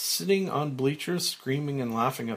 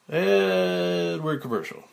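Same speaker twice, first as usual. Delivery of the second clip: slow, louder, higher called-out cue; stage manager; 4 semitones higher, 5 dB louder than usual. Acted. Stage manager announcing the commercial, drawn-out call. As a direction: draw out the cue across the studio.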